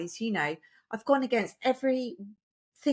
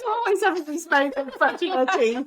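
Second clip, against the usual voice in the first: laughing tease; 8 semitones higher, 4 dB louder than usual. Real, not acted. Teasing friend while laughing.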